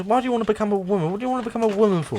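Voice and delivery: John Cleese character. Retold or imitated. Retelling Life of Brian, fast and exasperated. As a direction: rattle it off in disbelief. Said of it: "John Cleese's character".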